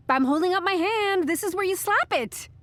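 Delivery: high, excited sing-out; elated, high-pitched cheer; gleeful celebration. Acted. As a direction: demand a high five in triumph. Celebrating, demanding a high five.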